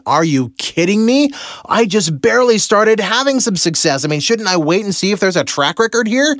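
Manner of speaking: high disbelieving protest; incredulous. Retold or imitated. Balking at the monthly price, high incredulous voice. Arguing with himself about the house.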